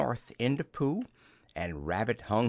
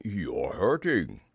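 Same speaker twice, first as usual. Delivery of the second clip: slow, pained character voice; drawn-out storybook protest; pained bear; 3 semitones lower, slower than usual. Acted. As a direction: complain slowly that it hurts. Pooh pulled by the paw.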